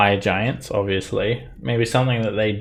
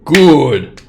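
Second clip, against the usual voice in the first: loud, high giant exclamation; booming giant; 7 semitones higher, 13 dB louder than usual. Acted. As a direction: bellow it like a pleased giant. GM voices golem/giant exclaiming.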